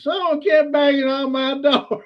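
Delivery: high, drawn-out imitation of someone going nuts; mock frantic complaint. Retelling the victim of a door-pounding prank, mock frantic. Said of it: "He was going nuts".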